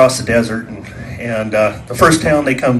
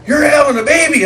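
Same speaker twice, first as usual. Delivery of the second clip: yelling over music; 13 semitones higher than usual. Retold or imitated. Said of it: "And he's like".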